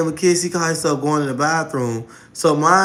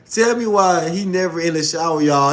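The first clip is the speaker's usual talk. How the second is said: normal talking voice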